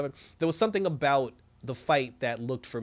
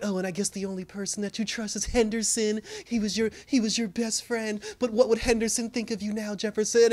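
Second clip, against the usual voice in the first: higher-pitched imitation of a reproachful wife; mock guilt-tripping; 6 semitones higher than usual. Wife hitting husband below the belt, retold, mock guilt-tripping. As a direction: put on a raised voice for the wife's jab. Acts wife's jab in quarrel.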